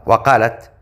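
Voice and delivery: usual speaking voice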